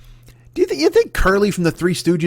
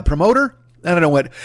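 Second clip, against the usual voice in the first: ordinary talk, nothing marked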